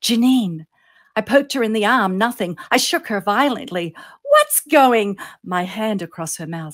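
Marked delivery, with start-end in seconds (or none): 0.0-0.6 raised, urgent call of a name; urgent hissed shout; whisper-yell. A narrator's reading. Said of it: "I whisper yelled". 4.2-5.2 high, loud cry on waking; alarmed question, cut short; startled outburst. A narrator's reading. Startled sleeper cut off mid-question.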